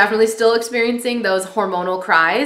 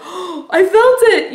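imitating an astonished husband, high and drawn out; amazed exclamation; 11 semitones higher, 5 dB louder, slower than usual. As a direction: exclaim in high, loud, slow wonder. Husband's amazed reaction, acted out.

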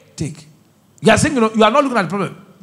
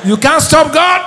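high, loud, defiant proclamation; shouted declaration; 6 semitones higher, 6 dB louder than usual. Real, not acted. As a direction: shout it at Satan with the crowd. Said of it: "Say with me".

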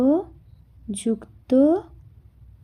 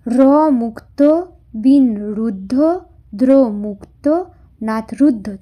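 slow syllable counting; slower than usual. Shown as a demonstration. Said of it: "তাহলে কি হচ্ছে এটা?".

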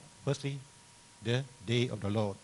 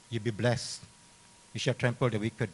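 normal talking voice

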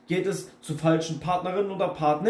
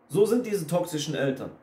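usual speaking voice

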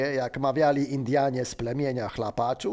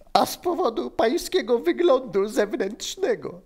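much higher, hammed-up amused voice; giggly high character voice; 9 semitones higher than usual. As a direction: play a man cracking up at someone. Benek's laughing fit, acted. (wysoki, rozbawiony głos)

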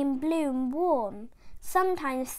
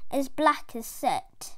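ordinary delivery

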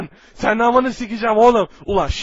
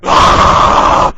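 loud rough roar of rage; 8 dB louder than usual. Acted. Hero lunges at the one who summoned him, rough roar. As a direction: let out a rasping roar.

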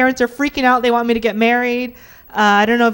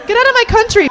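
higher, punchy mock shout; mock yell; 8 semitones higher, faster than usual. Comic bigot shouting at immigrants, mock yell. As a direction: snap it like an angry local.

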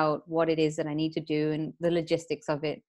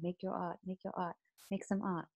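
inner voice; 13 dB quieter, faster than usual. Retold or imitated. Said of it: "that voice in the back of my head saying".

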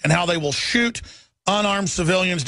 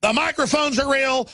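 usual speaking voice

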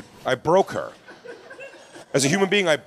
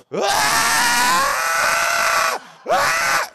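very high-pitched mock shrieks; two piercing screams, imitating a meltdown; imitated screaming. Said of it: "Starts screaming".

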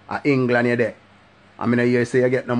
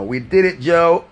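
loud, rising shout of the catchphrase; triumphant hollered catchphrase; 6 semitones higher, 6 dB louder, slower than usual. Acted. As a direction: belt it out like a victory cry.

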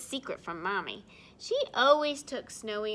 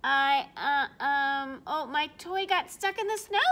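little bear's excuse; 2 semitones higher, 8 dB louder than usual. Acted. Snowy covering for being late, hesitant kid voice. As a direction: fumble for an excuse like a small child. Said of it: "Snowy told her".

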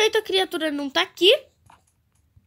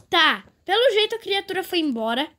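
ordinary talk

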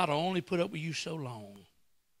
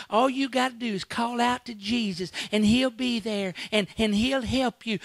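sweet preacher imitation; 6 semitones higher, 4 dB louder than usual. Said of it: "all we've ever heard".

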